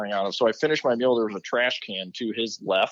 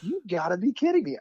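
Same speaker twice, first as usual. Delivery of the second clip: exasperated; 9 semitones higher than usual. Retold or imitated. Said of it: "I'm just like".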